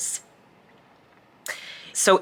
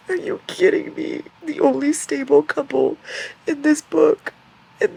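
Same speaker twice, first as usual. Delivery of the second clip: high, laughing disbelief; outraged fan; incredulous whine; 10 semitones higher than usual. Real, not acted.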